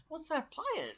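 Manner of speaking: put-on very high girl's voice; little girl asking. Neighbour girl asking about the video, very high. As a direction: pipe up like a small girl. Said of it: "and then she goes".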